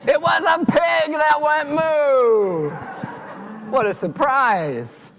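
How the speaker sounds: high, loud, drawn-out astonishment; amazed reveal. A narrator's reading. Storyteller reveals the pig that went moo to children, astonished. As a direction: announce it slowly as a huge surprise.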